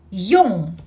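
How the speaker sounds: Yong with lips rounded, nasal back ending. Shown as a demonstration. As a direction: shape the lips beautifully on Yong. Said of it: "shaping your lips beautifully".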